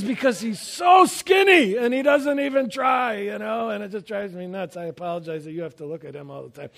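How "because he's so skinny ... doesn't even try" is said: louder, higher, jokingly exasperated; hammed-up envy; mock jealous outburst. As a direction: tease the skinny guy with a playful mock complaint. Teasing joke about skinny Jeff.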